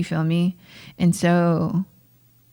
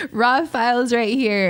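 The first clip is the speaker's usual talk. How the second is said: higher voice, fresh out of laughter; amused; 6 semitones higher than usual. Real, not acted. Host joking to fam about raw files, amused. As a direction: repeat it with the laugh still in your voice. Repeats it just after laughing.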